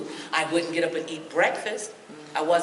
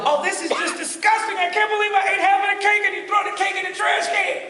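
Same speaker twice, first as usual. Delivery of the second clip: high, loud mock outrage; hammed-up self-disgust over the cake; 13 semitones higher, 5 dB louder than usual. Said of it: "Then you go".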